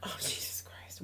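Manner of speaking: real exasperation, whispered; hushed, voiceless aside of disbelief; exasperated whisper. Real, not acted. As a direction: breathe it out under your breath. Exasperated reaction to partner's answer.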